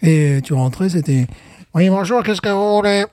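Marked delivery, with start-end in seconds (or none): 1.7-3.0 higher put-on shopkeeper voice, as if over an intercom; mock intercom voice. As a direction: play the shop clerk answering through the intercom. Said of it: "c'est pour ça que tu fais cette voix-là".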